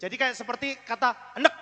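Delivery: normal talking voice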